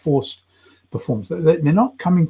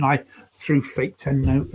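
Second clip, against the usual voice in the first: ordinary delivery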